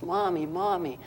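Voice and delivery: high, soft imitation of a child; daughter calling Mom. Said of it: "her daughter bursts through the door and says".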